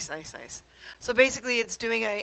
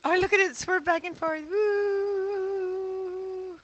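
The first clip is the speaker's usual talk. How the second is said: excited; 11 semitones higher, 3 dB louder, much slower than usual. Real, not acted. Watching the printer swerve.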